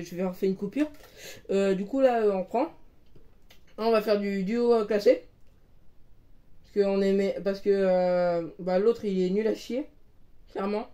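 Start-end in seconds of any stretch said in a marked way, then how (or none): none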